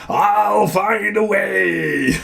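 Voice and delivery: very slow, high, drawn-out menacing shout; menacing villain line. Acted. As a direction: stretch it out like a cheesy movie villain. Villain voice after movie face.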